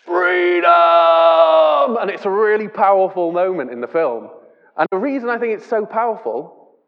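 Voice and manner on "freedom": long, loud sung-out shout; Wallace's final cry, held long and loud; dying battle cry. Retold or imitated. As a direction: pour his last breath into one defiant shout. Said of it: "takes it in and goes".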